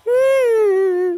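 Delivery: high, long wailing whimper; drawn-out sob. Acted. Mother breaks down before her son, sobbing. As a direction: let out a long keening cry. Mother weeping over runaway husband.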